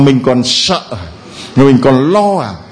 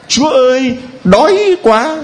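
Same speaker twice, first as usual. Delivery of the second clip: put-on much higher pleading child's whine; childlike cry to God; 10 semitones higher than usual. Believer crying to God like a hungry child. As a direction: beg God like a hungry little kid. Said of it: "có lúc mình cũng khóc như đứa bé".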